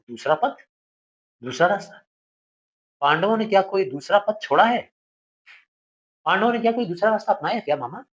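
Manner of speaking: the nephew bitterly challenging his uncle; indignant challenge. Acted. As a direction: throw the question back at him.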